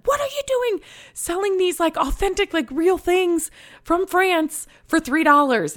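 very high-pitched mock outrage; imitated incredulous friend. Seller's friend objecting to the three-dollar price, high incredulous mimicry. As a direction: put on a squeaky, appalled friend's voice.

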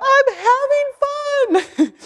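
very high and loud, bursting out; blurted out joy. Retold or imitated. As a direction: let the joy explode out of you all at once. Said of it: "I just blurted out".

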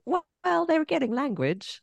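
high mock parent voice; a touch of a proud parent; imitating a parent. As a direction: parent sure their kid learns from the screen. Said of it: "a lot of parents going".

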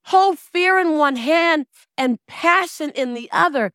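high, loud, slow exhortation; rallying speaker at full voice; motivational preaching. Real, not acted. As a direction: drive each phrase home to the crowd, slowly.

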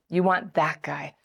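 ordinary talk

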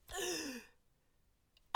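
high, shocked intake of breath; sharp gasp of horror. Retold or imitated. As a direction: gasp like you just realized. Horreur comique.

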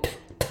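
プッ as a quick voiceless pop; 早い破裂音. Shown as a demonstration. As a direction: pop プッ short and fast. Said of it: "早く発音するみたいな感じのイメージで". (短く早いプッ)

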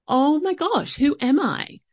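high-pitched, mock-overwhelmed self-questioning; acted bewilderment. Speaker reliving the question of who they are, bewildered. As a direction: leap up high, as if stunned by the question.

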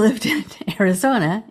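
normal talking voice

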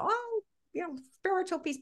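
high, scoffing sing-song; dismissive mockery. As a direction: roll your eyes at the idea. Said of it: "And I know people go".

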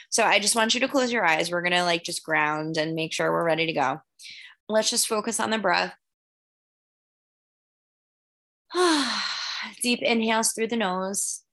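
calm guided breathing voice. Acted. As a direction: gently lead the breathing. Begins guided breathing meditation.

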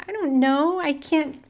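tossing back a flustered answer; exasperated retort. Retold or imitated. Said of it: "I'm like".

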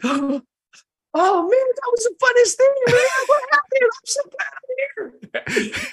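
very high, loud gushing after a gasp; mock exhilarated revival. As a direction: imitate a jumper coming round overjoyed. Imitates jumper waking up exhilarated.

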